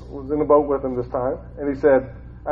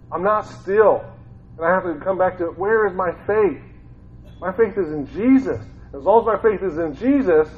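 usual speaking voice

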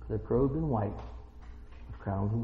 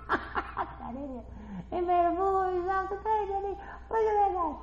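mocking onlookers; 12 semitones higher, 10 dB quieter than usual. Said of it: "everybody's going".

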